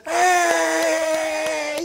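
loud high cheering cry; a crowd's loud rising whoop. Retold or imitated. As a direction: cheer like the whole pool watching. Whole crowd cheering the jumper.

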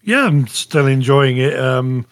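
normal talking voice

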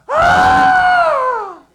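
very high, loud comic shriek of shock; terrified scream. Acted. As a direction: scream like a startled pallbearer. Pallbearers seeing the dead boy rise.

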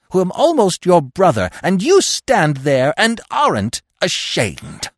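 high, impassioned, outraged accusation; building outrage. A narrator's reading. Fox accusing his near-brother the dog, bitter outrage.

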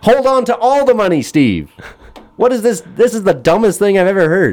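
high-pitched exasperated rant. Real, not acted. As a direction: scoff at the dumb advice, pitch up. Exasperated at the advice.

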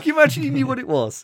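cracking up while asking; laughing. Real, not acted. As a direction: squeal it out between giggles. Laughing at Biden joke.

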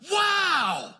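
very high, loud, astonished voice; amazed pleading. Retold or imitated. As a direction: burst out in wonder and beg eagerly. Acts out Jesse's amazed request.

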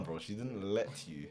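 ordinary talk, nothing marked

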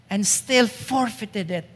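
usual speaking voice

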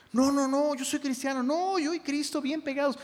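high-pitched mock protest; protesting believer. Pastor mimicking a believer who says he's fine, mock protesting.

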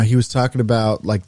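ordinary talk, nothing marked

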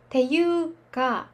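ていうか said slowly, each sound clear; slow pronunciation demo. Shown as a demonstration. Teacher modelling how ていうか sounds, slowly. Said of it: "ていうかって発音することも多".

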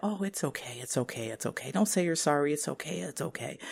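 soft, soothing reassurance; soft, comforting, whispered okays; reassuring. Retold or imitated. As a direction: hush them gently like calming a child. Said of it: "trying to reassure you".

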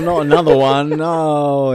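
hammed-up anguish at fresh bad luck; mock despair. [name] handed another summons, hammed-up despairing wail.

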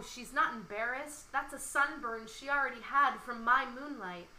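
lively spoken-word poem recital; poem reading. Acted. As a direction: perform the poem to the listener. Reads her love poem aloud.

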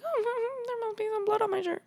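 imitating him in a high, soft, airy rush; super casual. Imitating his reply about the bloody shirt, super casual. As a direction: toss it off fast and lightly. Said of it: "Like, super cash".

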